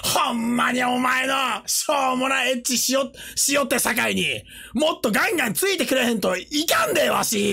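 high, hammed-up Kansai grumbling; overdone Kansai accent for laughs; thick Kansai dialect. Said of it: "コテコテの関西弁".

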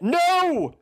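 very high, loud cry; shouted no. A narrator's reading. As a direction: scream it out in denial. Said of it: "Eothas shouted".

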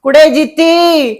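long, high, drawn-out call across the house; calling out loud. Acted. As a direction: shout her name slowly from afar. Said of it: "ਤੂੰ ਐਡੀ ਉੱਚੀ ਉੱਚੀ ਬੋਲ ਮਾਰੀ ਜਾਂਦੇ". (ਉੱਚੀ ਹਾਕ ਮਾਰ ਕੇ ਬੁਲਾਉਣਾ)

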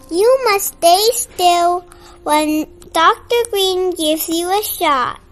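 ordinary delivery, nothing marked

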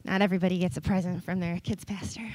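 fast, low whisper like a secret; conspiratorial hushed tease; playful whisper. Acted. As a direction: lean in and whisper it to the kids. Teasing secret aside about present.

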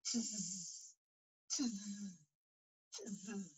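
soft hissing tss tss tss; quiet teasing monkey hiss; three soft mocking tss sounds. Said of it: "and said".

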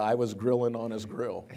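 ordinary talk, nothing marked

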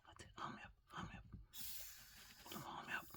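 whispered coaxing to a pet. Real, not acted. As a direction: softly coax it to bite, barely voiced.

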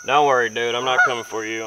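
ordinary talk, nothing marked

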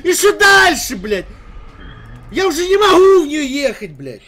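raging gamer. Real, not acted. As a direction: scream at the impossible ramp. Screams about the ramp.

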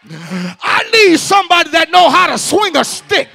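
loud, high preacher's call; preaching at full force. Acted. Preacher telling the church to grab a neighbor, loud. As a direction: shout it out to the whole church.

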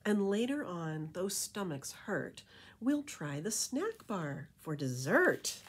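lilting rhymed storybook read; reading to children. A narrator's reading. Reading a bat beach story to kids, lilting rhyme. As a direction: read it like a bedtime picture book. Reading rhyming picture book aloud.

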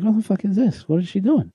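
high-pitched incredulous outburst; mock alarmed disbelief. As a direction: react in rising alarm, replaying the shock. Acting out his alarm at seatmate.

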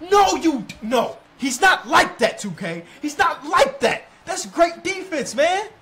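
high, loud, slowed protest and praise; excited yelling. Real, not acted. Gamer reacting loudly to a tense defensive stand, excited yelling. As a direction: shout at the screen in the heat of defense.

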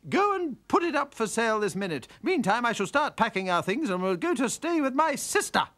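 high, loud, commanding orders; bossy wife. Acted. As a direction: order him about.

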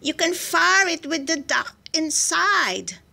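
high, slow, careful delivery; eager suggestion. A narrator's reading. Soldier suggesting a plan to the general, high and eager.

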